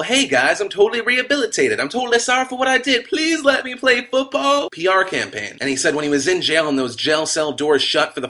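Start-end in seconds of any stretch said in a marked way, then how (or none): 0.0-4.7 much higher, pleading mock-sincere voice; hammed-up remorseful star; mock contrite athlete. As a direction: whine it like a fake apology for the cameras. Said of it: "doing that whole".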